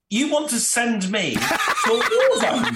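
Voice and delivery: incredulous, laughing at the absurd offer; laughing disbelief. Real, not acted.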